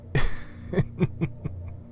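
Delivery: dark little storyteller's laugh; sinister chuckle. A narrator's reading. Horror narrator after saying dead men tell no tales, sinister chuckle.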